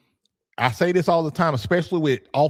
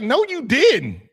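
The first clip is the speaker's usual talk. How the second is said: higher, slow, flat denial; irritated wife; 11 semitones higher, 4 dB louder, slower than usual. Retold or imitated. Wife slowly denying he told her. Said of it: "She's like".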